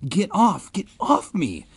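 hammed-up shrieking boy; mock shriek. A narrator's reading. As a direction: squeal it out in fake panic. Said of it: "Ben shrieked".

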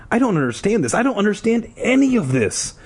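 high, exasperated confusion; bewildered protest. A narrator's reading. Character demands answers, bewildered.

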